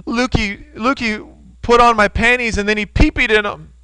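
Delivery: put-on high, childlike tattling voice; little daughter's voice. Three-year-old daughter tattling to dad, high childlike voice. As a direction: pipe up like a three-year-old telling on her brother. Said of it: "And she says".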